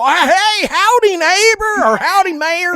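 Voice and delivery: loud, high southern hollering; southern accent. Retold or imitated. Player mimicking Walnut hailing the mayor, loud southern accent. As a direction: call out a hammed-up southern howdy. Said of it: "he pulls out a southern accent".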